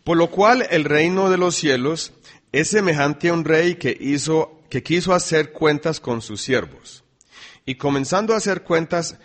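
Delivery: loud, ringing Bible reading to the church; scripture reading. Acted. Preacher reading Mateo aloud, loud and ringing. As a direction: proclaim the verses to the congregation.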